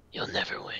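whispered menacing taunt; creepy whisper. Pretend. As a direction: hiss it like a villain. Taunting whisper after friend wins.

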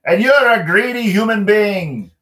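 high, loud furious leprechaun; angry retort. Acted. O'Leary stomping at Michael, angry retort. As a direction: fling the insult back. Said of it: "O'Leary stomped his foot".

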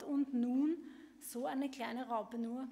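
ordinary talk

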